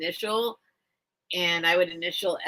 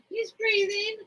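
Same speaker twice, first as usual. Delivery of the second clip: relieved pet talk; 13 semitones higher than usual. Real, not acted. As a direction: coo at the bird, pitched way up. Relieved, to the bird.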